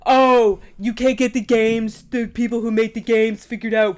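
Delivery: mock them with exaggerated, slowed words; mocking retort. Mocking the other's complaint in an argument.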